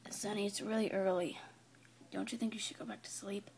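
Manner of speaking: low, hushed, half-awake voice; drowsy near-whisper; sleepy murmur. Acted. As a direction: softly coax them back to bed. Sleepy friend answers early riser.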